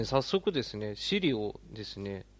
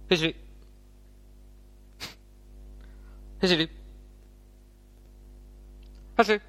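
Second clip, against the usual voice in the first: as fast as possible; 5 semitones higher, much faster than usual. Shown as a demonstration. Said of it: "最速で呼んでみる".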